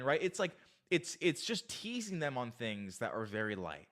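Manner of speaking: usual speaking voice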